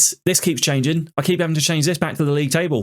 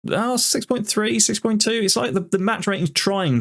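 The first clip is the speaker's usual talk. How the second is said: exasperated disbelief; 7 semitones higher than usual. Real, not acted. Exasperated at match rating.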